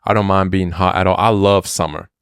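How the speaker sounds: normal talking voice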